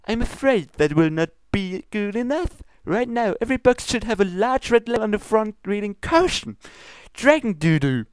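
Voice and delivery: high, formal princess scolding; lofty royal reproach, pitched high. Acted. Luna scolds Pinkie about her ingredients, high and prim. Luna demanding warning label.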